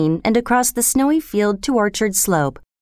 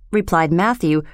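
usual speaking voice

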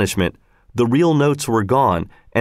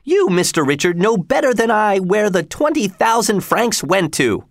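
high-pitched outraged protest; indignant cry; 9 semitones higher than usual. A narrator's reading. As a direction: protest your innocence, voice jumping right up. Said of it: "she cried".

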